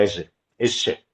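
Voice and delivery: imitating his old trouble, saying "Eche, eche" for "erre, erre"; childlike mispronounced "Eche" instead of trilled R; lisped R. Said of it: "Porque yo decía".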